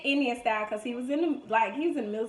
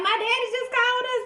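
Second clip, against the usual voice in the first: put-on child's reply; 12 semitones higher, 6 dB louder than usual. Child answering cousins.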